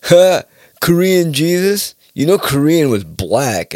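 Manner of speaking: high, slow mocking voice of an outraged gamer. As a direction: sneer it out like a clueless player. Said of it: "They're like".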